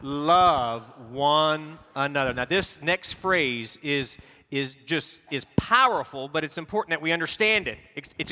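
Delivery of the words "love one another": slow, loud, drawn out; said all out loud. Shown as a demonstration. Pastor leading congregation, slow and loud. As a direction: boom it out together with the room. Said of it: "Let's say it all out loud".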